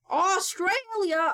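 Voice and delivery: high, loud, drawn-out triumphant guess; excited guess. Real, not acted. Streamer finally guessing the right country, excited shout. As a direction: shout it out like you finally got it.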